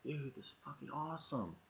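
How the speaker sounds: whisper-thrilled, so no one hears; sneaky excited hush. Retold or imitated. First dip at school.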